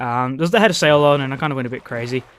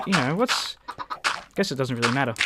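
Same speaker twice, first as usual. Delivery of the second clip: ordinary talk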